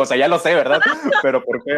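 laughing while asking; amused pressing question; asking through laughter. Retold or imitated. Laughing quote to sister.

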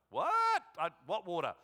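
mock-bewildered squawk from a confused woman; baffled surprise. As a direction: shoot up high and loud, then puzzle it out. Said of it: "and she's like".

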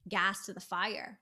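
normal talking voice

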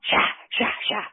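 sharp repeated "sha" swishes; vocal slashing sound effect; imitated slashing noise. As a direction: mimic blade swipes in quick separate bursts.

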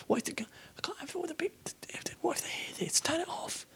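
high, panicked whisper; frantic hushed whisper, afraid to be heard; worried whisper. Real, not acted. Panicked whisper, fears listeners.

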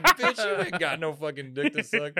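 high, slow comeback through laughter; amused, drawn-out mock insult; laughing retort. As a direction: retell the comeback while cracking up. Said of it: "And I was like".

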